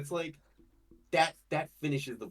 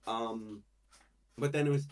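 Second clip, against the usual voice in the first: ordinary talk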